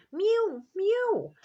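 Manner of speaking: very high, loud mew; a cat crying to be let in. A narrator's reading. Simpkin mews at the shop door, high and loud. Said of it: "interrupted Simpkin".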